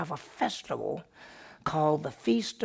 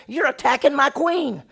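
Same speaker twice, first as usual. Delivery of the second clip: high and loud outrage; the king furious at Haman; outraged king; 10 semitones higher, 8 dB louder than usual. Acted. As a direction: catch him in the act, furious.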